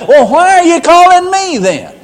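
high, loud exasperated protest; upset. Acted. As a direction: snap back at a pointless caller. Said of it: "he'd get a little upset about that".

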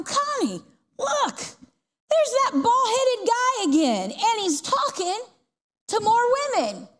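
high, slow, pointed gossip to a friend; high gossipy whisper. Retold or imitated. Leanne points out the bald man to Connie, high and slow. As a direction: hiss it to Connie like catty gossip.

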